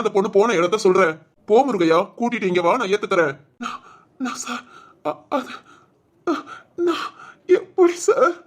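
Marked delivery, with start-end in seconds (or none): 3.6-5.5 faltering whisper, barely audible; stammered whisper to the boss. Acted. Cornered servant falters. 6.3-8.3 high, slow, sobbing question; a broken cry of helplessness; tearful wail to the boss. Acted. Servant breaks down.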